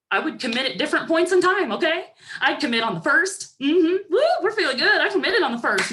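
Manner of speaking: high, loud, hyped-up cheer. Retold or imitated. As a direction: ride the start-of-month buzz with big energy. Hyped start-of-month self.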